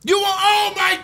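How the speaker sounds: high, squeaky shocked outburst. Real, not acted. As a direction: voice shoots up in disbelief. Outrage at the chicken wings pick.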